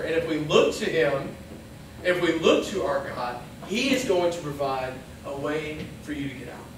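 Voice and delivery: normal talking voice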